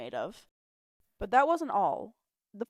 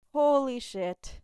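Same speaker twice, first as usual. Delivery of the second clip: deflated; 6 semitones higher, 5 dB louder, much slower than usual. A narrator's reading. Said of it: "Guy deflated".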